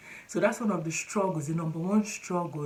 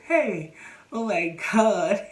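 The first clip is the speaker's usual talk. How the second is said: slow, louder, laughing; laughing exasperation; 4 semitones higher, 4 dB louder, slower than usual. Real, not acted. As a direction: sigh it out in laughter.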